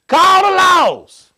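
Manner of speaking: loud, very slow comic yell. Acted. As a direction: stretch it out, shouting. Punchline of fight plan.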